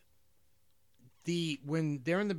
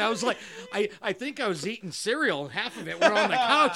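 ordinary delivery

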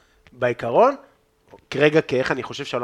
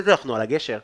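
ordinary talk

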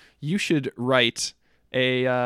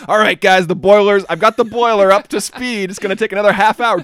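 train driver call; 5 semitones higher, 9 dB louder than usual. Retold or imitated. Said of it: "I'm like".

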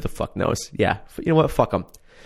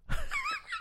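laughing; 12 dB quieter than usual. Real, not acted. Laughing at the stupid show.

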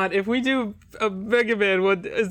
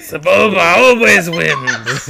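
alien creature voice; 5 semitones lower, 11 dB louder, slower than usual. Acted. Asked to find a voice.

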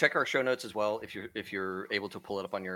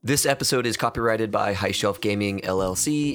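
loud, steady announcer outro; podcast outro read; 5 dB louder than usual. Acted. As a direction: read the sign-off like an ad. Outro read over music.

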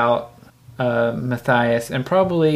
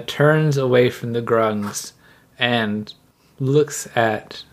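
ordinary talk

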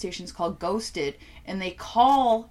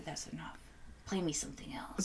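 much softer, breathy whisper; whispered plea; 5 semitones lower, 16 dB quieter than usual. Retold or imitated. Telling the radio to stop, in a whisper. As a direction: hush it, a tired whispered request. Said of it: "I say".

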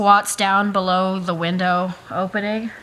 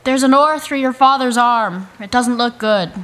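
raised in-character voice; urgent calling out; 5 semitones higher, 8 dB louder than usual. Acted. Rescuer warns the son about his father's arm, calling loudly. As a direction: call the bad news out loudly.

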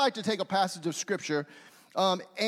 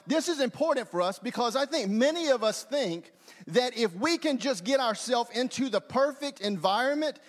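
ordinary delivery

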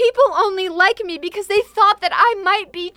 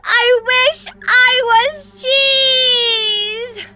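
slow, loud, crying wish; tearful cry; 6 semitones higher, 6 dB louder, much slower than usual. Acted. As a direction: wail the wish out. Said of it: "If you say it one more time".

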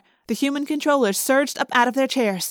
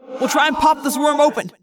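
raised, tense voice; urgent rallying; 4 semitones higher than usual. Acted. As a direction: hold the team together under pressure. Urgent plan inside the monster.

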